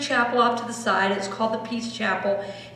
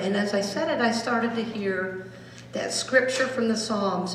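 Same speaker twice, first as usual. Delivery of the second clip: ordinary talk, nothing marked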